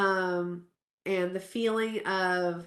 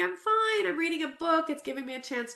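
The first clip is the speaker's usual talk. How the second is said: breezy false cheer; 8 semitones higher than usual. Retold or imitated. Cheerily reassuring everyone she's fine.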